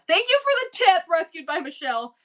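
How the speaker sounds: high, bright burst of gratitude; squealing thank-you to a tipper; excited thanks. Real, not acted. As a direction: gush your thanks upward.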